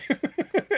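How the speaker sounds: a sudden high burst of laughter. Real, not acted. Laughs at their own dumbness.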